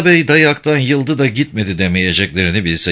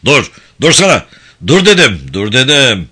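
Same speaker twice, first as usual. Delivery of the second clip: shouting stop; 4 dB louder than usual. Acted. Enraged director stopping the head guard leaving, loud shout. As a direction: yell him to a halt.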